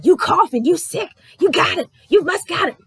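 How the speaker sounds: loud, high, accusing mock voice; mocking accusers. People accusing anyone who coughs, loud mock accusation. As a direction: parrot the paranoid crowd.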